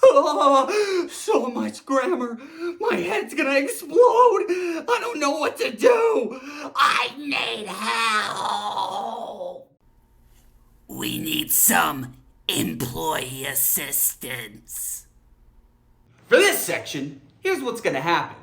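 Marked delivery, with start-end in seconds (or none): 0.0-9.7 high, laughing-crying shouts; frantic, hysterical cry for help; hammed-up meltdown. Acted grammar meltdown. 10.9-15.1 low, very slow trailer voice; deep, slow, portentous announcement; dramatic announcer. Pretend. Dramatic announcement after meltdown.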